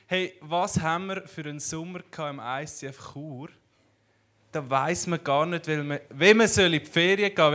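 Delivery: ordinary talk, nothing marked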